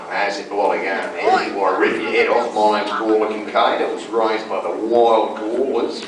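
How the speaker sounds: low, broad Australian; stock Australian accent. Shown as a demonstration. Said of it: "usually if you play an Australian".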